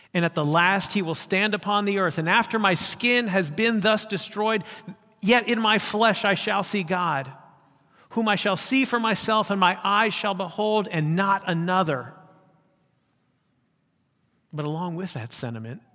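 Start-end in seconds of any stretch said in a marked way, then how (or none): 0.1-12.1 preached declaration. Acted. Job's declaration read with force.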